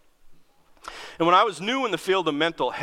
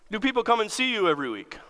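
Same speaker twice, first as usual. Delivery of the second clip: higher, quick pointed question; Wendy questioning; 9 semitones higher, faster than usual. Retold or imitated. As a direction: rattle it off like a probing supervisor. Wendy quizzing.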